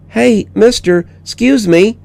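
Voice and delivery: put-on high child's voice calling up; high little girl getting his attention. A narrator's reading. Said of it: "the voice came again".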